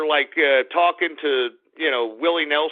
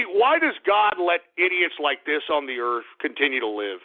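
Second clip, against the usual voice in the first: ordinary delivery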